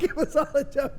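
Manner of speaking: high voice breaking into laughter; giggling repeat of the punchline; laughing through it. Retold or imitated. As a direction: laugh while repeating the line.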